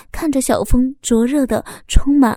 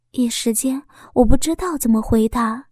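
ordinary delivery, nothing marked

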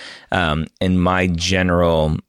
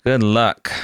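normal talking voice

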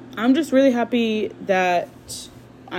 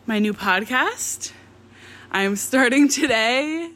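normal talking voice

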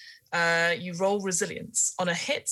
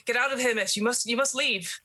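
higher, urgent servant voice; 6 semitones higher than usual. Acted. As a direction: push the lady out, pleading. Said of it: "the servant is like".

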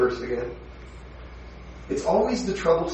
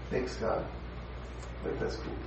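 softly breathed, half-joking thanks; near-whispered pretend gratitude; hushed mock thanks; 15 dB quieter than usual. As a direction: murmur it like a grudging prayer. Mock grateful response to suffering.

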